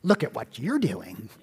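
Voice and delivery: low, slow, self-righteous disapproval; sanctimonious tut-tutting, drawn out and soft; Church Lady. Acted. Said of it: "become sort of like the church lady".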